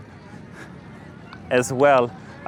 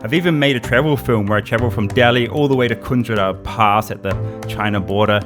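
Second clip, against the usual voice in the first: low, even outro voiceover; voiceover narration; 5 semitones lower than usual. Acted. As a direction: read the outro like a calm narrator. Voiceover outro over music.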